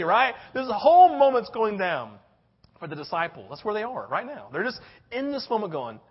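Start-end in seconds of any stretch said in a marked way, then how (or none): none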